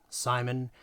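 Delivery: usual speaking voice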